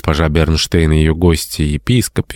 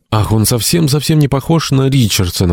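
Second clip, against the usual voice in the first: put-on higher-pitched girlish exclamation, narrated; exclaiming; 8 semitones higher than usual. A narrator's reading. Theo to her family about the man seen opposite, exclaiming. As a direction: read it as a mild, high exclamation. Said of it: "воскликнула Тео".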